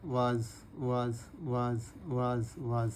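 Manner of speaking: very slow, soft वाज repeated; slow quiet drill. Shown as a demonstration. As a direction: draw out each वाज while writing. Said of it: "bracket बंद करना है".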